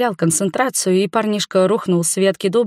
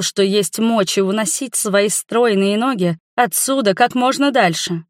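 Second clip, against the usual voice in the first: ordinary delivery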